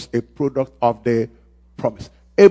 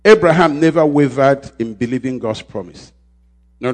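usual speaking voice

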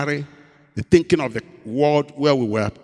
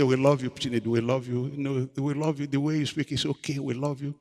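soft, low loving words; tender reassurance; 3 semitones lower, 7 dB quieter than usual. Retold or imitated. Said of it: "They say".

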